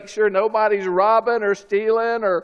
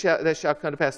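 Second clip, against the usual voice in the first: ordinary delivery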